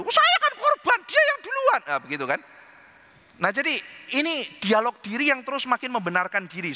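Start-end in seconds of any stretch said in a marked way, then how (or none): 0.0-1.8 very high, loud whiny excuse; whiny victim voice. Retold or imitated. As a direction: squeal it like an offended victim. Asaf plays the victim. (suara korban merengek tinggi)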